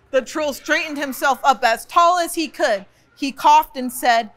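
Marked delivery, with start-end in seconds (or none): none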